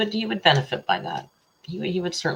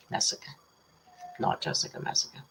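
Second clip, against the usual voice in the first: teasing; 7 dB quieter, faster than usual. Real, not acted. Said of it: "I'm just teasing".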